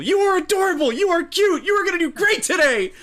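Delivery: high, loud self-affirming scream; screaming pep talk. Acted. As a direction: scream compliments like an angry drill. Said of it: "just screaming at my reflection in a puddle".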